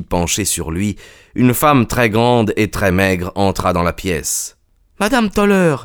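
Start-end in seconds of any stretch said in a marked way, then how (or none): 5.0-5.8 put-on high-pitched cry of a young woman; exclaiming. A narrator's reading. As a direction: call the name out, voice right up. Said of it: "s'écria la jeune gouvernante". (exclamation aiguë de la gouvernante)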